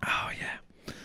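whisper. Real, not acted. Overhearing a line about drinking, reacting.

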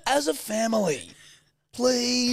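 high, loud mock begging; hammed-up squeaky plea; pleading kid. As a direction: beg the family in a child's voice. Joins in playing pleading kid.

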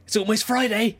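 high, bright mock office worker; chirpy coworker. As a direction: lightly imitate an eager colleague. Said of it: "it's like Tuesday morning".

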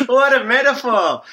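laughing high. Real, not acted. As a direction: laugh through the words. Laughing at the metaphor.

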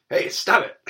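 high, loud scolding command; mock rebuke. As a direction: snap the storm into silence. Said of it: "And so Jesus is like".

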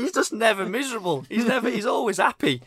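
comically exasperated; mock exasperation. Real, not acted. Mock exasperation at chef's cheerfulness.

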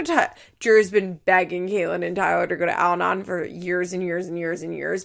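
normal talking voice

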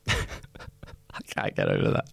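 weak, wheezing words through laughter; helpless laughter. Real, not acted. Host cracking up over his friend's salmonella, laughing. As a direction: barely get it out for laughing.

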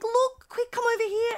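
high, eager retold summons; excited calling over. Woman calling cousins to see a joey, excited.

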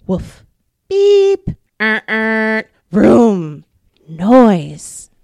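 loud playful noise sounds; bark, beep and vroom like the city; imitated noises. Librarian imitating loud city noises for kids.

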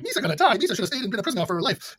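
high, fast, silly Gungan whine; Jar Jar voice. Retold or imitated. Retelling the movie, imitating Jar Jar in a high silly voice. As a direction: squeak it out like a panicky Jar Jar. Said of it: "Jar Jar's like".

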